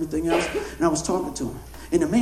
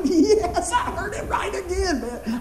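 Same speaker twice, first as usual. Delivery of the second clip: high, laughing cry of delight, slower; excited whoop; 11 semitones higher, much slower than usual. Retold or imitated. Preacher reliving his joy after the answer came true. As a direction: savour it with a laughing yes. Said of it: "I'm all excited now".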